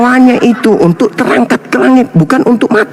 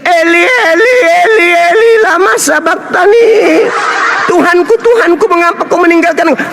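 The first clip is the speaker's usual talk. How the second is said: mock anguished cry; 7 semitones higher than usual. Jesus crying out on the cross, mocked and hammed-up. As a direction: ham up a desperate cry to God. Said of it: "dia teriak".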